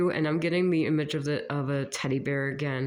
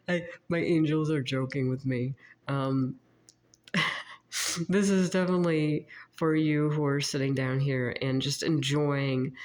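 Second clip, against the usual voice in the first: usual speaking voice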